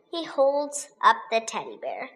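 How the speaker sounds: usual speaking voice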